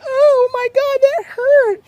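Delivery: very high, loud whiny wail; hammed-up whine over getting hurt; mock whining. Player making a show of being hit, mock whining. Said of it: "instead of whining about it".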